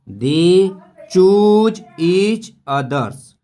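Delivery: loud, high, slow "They choose each others"; emphatic reading. Shown as a demonstration. Teacher proclaims the story's ending line to students. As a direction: announce the line as a climax.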